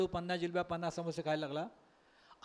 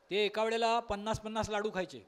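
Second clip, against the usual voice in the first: ordinary delivery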